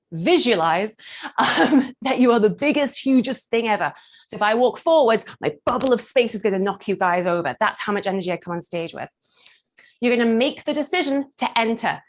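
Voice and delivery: ordinary talk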